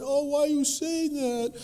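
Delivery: high-pitched, wounded coaxing; mock a hurt parent, voice lifted high; pleading parent. Acted hurt nurturing parent pleading.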